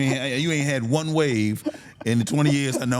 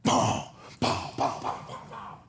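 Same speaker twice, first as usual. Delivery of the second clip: unvoiced popping gun noises; imitated gunshots; 4 semitones higher, slower than usual. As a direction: mimic pistol shots going off.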